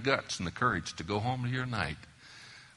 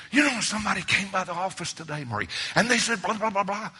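fast, eager rush, pitched up; retold excitement; 8 semitones higher, 4 dB louder, faster than usual. Preacher replaying bragging to his wife, fast. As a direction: blurt it out like big news. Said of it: "I'd come home all excited".

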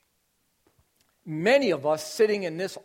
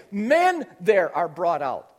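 ordinary talk

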